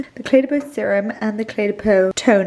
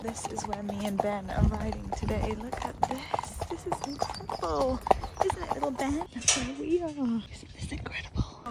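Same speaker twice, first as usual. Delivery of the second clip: whispering; 10 dB quieter than usual. Real, not acted. Whispering beside the horse.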